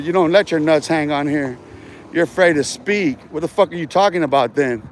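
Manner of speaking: ordinary talk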